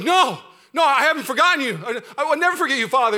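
put-on high, loud and fast protest of a young lion; pleading Simba. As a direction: protest hard like a hurt son. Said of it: "And Simba's like".

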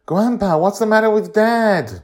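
put-on high, loud scared little girl; girl's frightened plea; frightened child. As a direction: call out like a worried child. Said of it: "Joy is frightened".

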